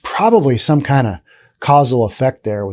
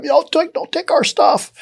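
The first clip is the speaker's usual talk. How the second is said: retold scream; 14 semitones higher than usual. Podcaster retelling a manager screaming at a shoplifter, high mock yell. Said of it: "manager's screaming at him".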